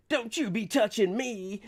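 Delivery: a high, put-on woman's voice pushing him off; sassy lady voice. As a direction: make her sound offended and sassy. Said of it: "It's like".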